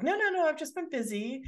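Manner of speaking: airy high no no no, brushing him off; high dismissive brush-off. Retold or imitated. Carrie brushing off Big in the car, high and airy.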